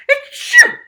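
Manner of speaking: huge, high, loud sneeze blast; explosive sneeze. Acted. Teacher bursts into the waiting room, exploding sneeze. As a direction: sneeze as if it blows the room apart. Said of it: "explosive sneezing".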